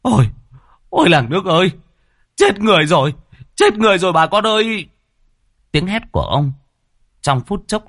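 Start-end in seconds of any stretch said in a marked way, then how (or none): 0.0-4.9 high, loud alarm cry; frantic shout. A narrator's reading. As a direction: scream to alert the village. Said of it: "rú lên như là cháy nhà".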